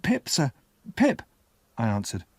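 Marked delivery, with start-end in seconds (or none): 0.0-1.2 high, small whispery answer; scared boy. A narrator's reading. Boy gives the convict his name, scared and small. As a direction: answer timidly in a child's high voice.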